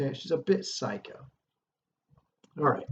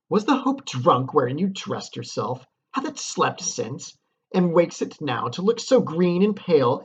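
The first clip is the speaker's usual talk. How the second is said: higher and louder, mocking challenge; scornful dramatic reading; 4 semitones higher, 6 dB louder than usual. Teacher reads Lady Macbeth goading her husband, scornful declamation. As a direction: taunt him like a stage Lady Macbeth.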